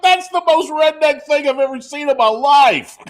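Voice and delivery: high, loud incredulous exclaim; can't believe what you saw; amazed disbelief. Retold or imitated. Amazed self quote.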